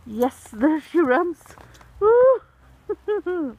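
loud, high whoop; excited cheer. Real, not acted. Watching the cat as there she runs, excited cheer. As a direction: cheer the runner on loudly.

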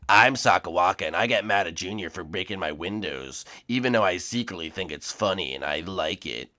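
low, soft Sakawaka voice; deep, quiet skeleton impression. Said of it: "that Sakawaka impression".

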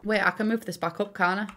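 ordinary delivery

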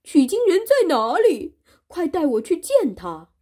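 high, loud and urgent; eager question. Acted. Monster eagerly asking Wukong for the pilgrim. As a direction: ask in a rush to meet him. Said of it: "忙问".

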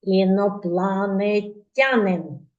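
Інопланетянин said slowly, syllable by syllable; slow, careful Інопланетянин; word spelt out clearly. Shown as a demonstration. Teacher models for children: Інопланетянин drawn out. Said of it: "Повторіть разом зі мною".

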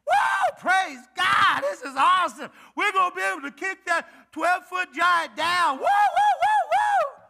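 loud, high whooping crowd; hammed-up cheer like a rowdy mob; shouting Israelites cheering wildly; mock excited cheering. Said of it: "Not what he said".

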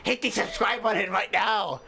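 hammed-up Japanese-accented sign-off; horrible Japanese accent. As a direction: plug the channel in a fake Japanese accent. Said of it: "doing a horrible Japanese accent".

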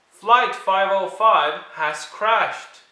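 high, loud, slow mock pilot alarm; pilot announcement. Luther playing pilot, loud mock alarm.

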